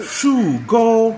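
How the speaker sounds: SHU GO held high and loud; copying teacher's tones. Shown as a demonstration. Repeating after the Chinese teacher, high loud SHU GO. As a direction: echo "Shu go" on high, level pitches. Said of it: "She's teaching me how to".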